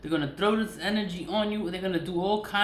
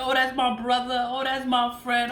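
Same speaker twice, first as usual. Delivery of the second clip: higher, louder naive sing-song; mock gullible friend; 5 semitones higher, 4 dB louder than usual. Imitating people who trust the man, mock naive. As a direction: lightly mimic the fooled people. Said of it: "They be like".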